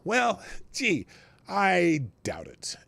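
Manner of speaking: high "well, gee", then slow doubt; drawn-out sarcastic doubt. Acted. Scoffing at valuation claim.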